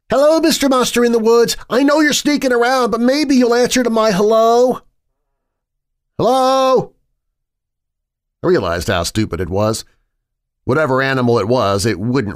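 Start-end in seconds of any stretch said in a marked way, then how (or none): 0.1-6.9 high, loud sing-song taunt; mocking high voice. Josh taunting the unseen monster in a high mock voice. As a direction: tease the monster like a child.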